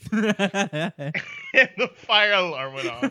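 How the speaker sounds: words broken up by laughter; can barely get it out for laughing; laughing through it. Real, not acted. Telling friends the dorm story, laughing.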